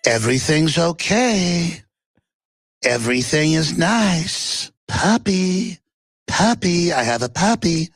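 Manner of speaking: put-on high, slow, sugary baby talk; mock soothing. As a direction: coo it like to a toddler. Soothing voice mocking a clip.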